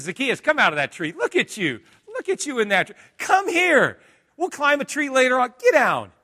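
high, loud call up into a tree. Acted. Jesus calling Zacchaeus down out of the tree, loud and high. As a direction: shout up to the man in the tree, friendly.

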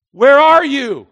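loud questioning call. Acted. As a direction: call out across the garden. God calling louder.

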